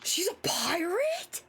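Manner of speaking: breathless whispered gasp of surprise. Real, not acted. Reacting to the pirate lore, a gasped whisper.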